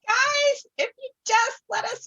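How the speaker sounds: high, loud appeal; pleading shout. Acted. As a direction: call out over them to plead. Pleading loudly with the kobolds.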